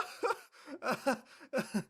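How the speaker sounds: high, half-screaming laugh; squealing laugh. Acted. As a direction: laugh out a shriek while being pulled. Dragged onto court laughing.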